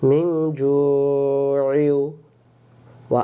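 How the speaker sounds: very slow, melodic drawn-out recitation; Quran recitation. Shown as a demonstration. Teacher modelling the correct words for students, slow chant. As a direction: stretch মিন and জু'ইউ in a long chant. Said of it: "recites word as model".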